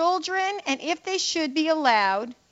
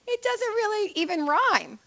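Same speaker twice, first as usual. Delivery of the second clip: higher, amused and incredulous; amused disbelief; 8 semitones higher than usual. Real, not acted. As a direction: laugh off how bad the poem is.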